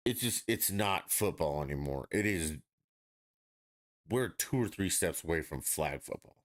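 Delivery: ordinary delivery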